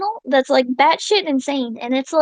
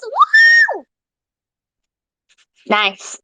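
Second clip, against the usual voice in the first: normal talking voice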